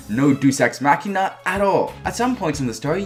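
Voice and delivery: usual speaking voice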